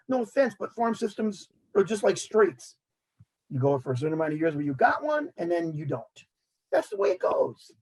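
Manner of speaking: normal talking voice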